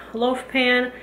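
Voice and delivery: ordinary talk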